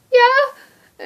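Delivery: loud, high squealed reply; high-pitched excited yell; excited shriek. Retold or imitated. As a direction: shriek it with sudden joy. Friend answers about floor seats.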